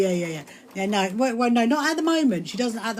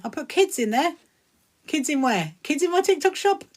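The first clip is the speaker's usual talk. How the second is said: puzzled, rising higher to incredulous; baffled questioning; 7 semitones higher than usual. Real, not acted. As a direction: query it in mounting disbelief. Baffled by misread viewer comment.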